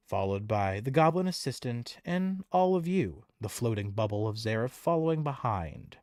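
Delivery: lively, building game master narration; excited fantasy narration; scene setting. A narrator's reading. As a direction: paint the carnival for the players. Game master describes the carnival.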